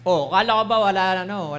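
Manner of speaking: preacher lightly voicing the devil's taunt. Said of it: "Yung ganun yung boses ng demonyo".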